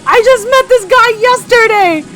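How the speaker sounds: high, loud voice cracking into laughter; breaking up mid-line; giggling through the character voice; laughing. Real, not acted. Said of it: "I just think that's kind of funny to say".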